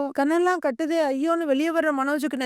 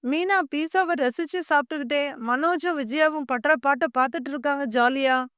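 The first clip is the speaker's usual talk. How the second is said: ordinary delivery, nothing marked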